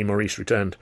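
plain reading voice

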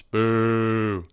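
long, drawn-out boo. A narrator's reading. Club jeering the overlord, mild sustained boo. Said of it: "This was followed by boos".

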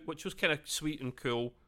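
usual speaking voice

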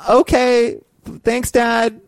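put-on high sweet child voice; kid voice. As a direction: thank dad like a little kid. Kid to dad.

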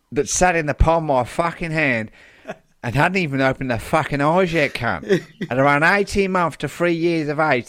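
hammed-up broad Australian carer; Australian accent. Retrying the wildlife carer's quote, broad Australian accent. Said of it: "hooks me back into the accent".

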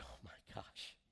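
hushed, breathless whisper; stunned rich man, murmuring in wonder; awed whisper. Retold or imitated. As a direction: whisper it in awe. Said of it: "It's like".

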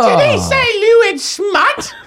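very high, slow mock-shocked squeak; high squeaky voice. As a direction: stretch it out in a falsetto.

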